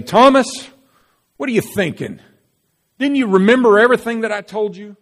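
high, loud, fast exasperated rebuke; exasperated scolding. Pretend. Jesus scolding doubting Thomas, loud and exasperated. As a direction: scold Thomas in mock frustration.

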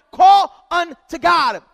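"Call unto God" belted out, drawn slow; slow shouted call; loud slow refrain. Acted. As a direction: hammer the refrain home. Refrain in preached list.

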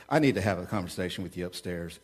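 a hint of a low, stern father; lightly voiced dad summoning his son; low, grave, understated warning. Stern dad calls teen son.

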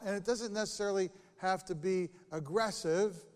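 usual speaking voice

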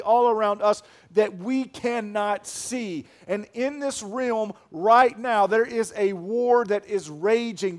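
loud, slow, emphatic preaching; preaching at full force. Acted. Pastor warning the church of the war, full-force preaching. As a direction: drive the warning home to the congregation.

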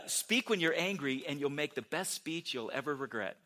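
emphatic second take. Shown as a demonstration. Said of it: "let me say it again".

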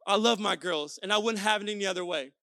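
ordinary delivery

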